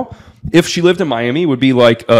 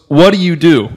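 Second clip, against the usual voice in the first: imitated Russian accent, pitched up; mock Russian-accented woman, higher voice; 5 semitones higher, 4 dB louder than usual. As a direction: put on a strong Russian accent for a retold question. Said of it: "very strong Russian accent".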